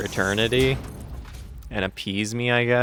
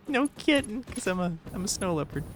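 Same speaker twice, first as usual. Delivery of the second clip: "No kitten" much higher, then rattled off fast; playful squeal; 9 semitones higher, 6 dB quieter, faster than usual. Pretend. Streamer giddily insists to chat she is a snow leopard, high and fast. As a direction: tease the chat with a giddy pun.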